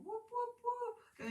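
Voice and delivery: high excited whoops; playful high cheer for the class. Real, not acted. Cheering class near the end.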